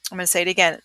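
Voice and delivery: normal talking voice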